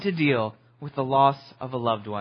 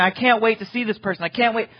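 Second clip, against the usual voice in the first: eager anticipation; 6 semitones higher, faster than usual. Retold or imitated. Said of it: "You start counting down the days going".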